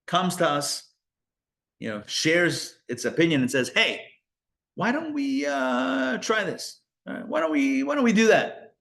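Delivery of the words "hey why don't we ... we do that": tempting voice. Acted. Voicing tempting inner voice.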